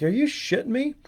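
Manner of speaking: high, incredulous squeak of surprise; stunned disbelief. Retold or imitated. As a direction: pitch jumps way up in shocked disbelief. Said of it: "I'm like".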